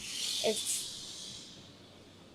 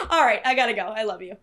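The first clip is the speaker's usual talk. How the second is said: laughing, louder, while signing off; dad cracking up; 4 dB louder, faster than usual. Retold or imitated. Dad laughing as he ends the phone fight. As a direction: laugh it off and wrap up the call. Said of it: "he just starts cracking up".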